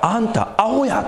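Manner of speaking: a light, admittedly clumsy Kansai dialect jab; mock Kansai retort. Preacher mocks a complainer in half-hearted Kansai dialect. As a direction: toss off a Kansai-dialect "you're an idiot" without really managing the accent. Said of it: "僕関西弁できないから".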